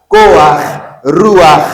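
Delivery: loud, crisp "Koach", "Ruach", each ending in guttural ch; furtive patah demo. Shown as a demonstration. Teacher drills the class, loud "Koach", "Ruach" with final ch. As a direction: punch out "Koach", "Ruach", a before ch. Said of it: "on y va".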